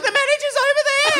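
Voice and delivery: very high, fast, panicked squeak; high fast frightened DJ voice; scared DJ. Retold or imitated. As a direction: squeak it out like a spooked DJ. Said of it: "He's like".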